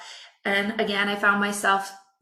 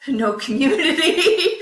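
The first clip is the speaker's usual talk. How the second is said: real laugh, higher; 8 semitones higher, 4 dB louder than usual. Real, not acted. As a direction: laugh while naming what was missing. Laughs at starting from nothing.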